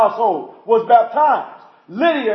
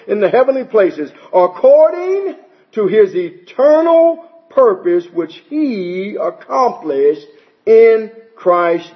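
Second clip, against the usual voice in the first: a solemn reading from the pulpit, slowing to a halt at the close; scripture reading; 4 semitones lower than usual. Acted. Preacher reads Ephesians aloud to his congregation, solemn and deliberate. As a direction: read the verses out to the church, building toward the last line.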